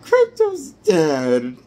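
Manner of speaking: high, slow mock wail through laughter; mock crying fans. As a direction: wail it like a sobbing fan. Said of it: "Superman fans were just crying over".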